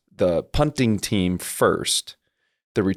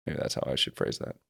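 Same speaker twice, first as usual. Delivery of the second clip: whispered under his breath, much softer and lower; muttered aside; 6 semitones lower, 11 dB quieter than usual. Real, not acted. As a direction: mumble it to yourself like an afterthought. Muttering self-correction to himself.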